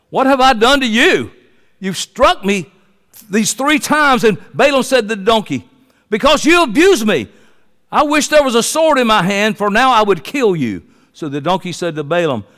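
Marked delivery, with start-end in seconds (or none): 0.1-10.8 dialogue read aloud. Acted. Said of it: "she said to Balaam".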